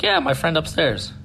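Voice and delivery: put-on high, matter-of-fact kid voice; little boy. Boy assures the adults about his friend, imitated child. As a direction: imitate a small child confirming it.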